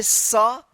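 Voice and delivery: "स" as a drawn-out hissing s; C as S sound. Shown as a demonstration. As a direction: sound out "स" like English s. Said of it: "सी का दो उच्चारण होता है". ("स" को लंबी 'स' ध्वनि में बोलें)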